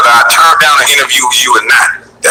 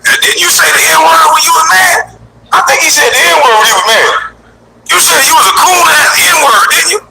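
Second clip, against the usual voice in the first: high-pitched mocking voice; mocking taunt. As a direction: tease him in a silly high voice. Taunting him in the put-on voice.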